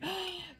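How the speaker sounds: sharp little intake of breath in delight; real delighted gasp. Real, not acted. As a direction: gasp at the surprise. Sees lighthouse lights up.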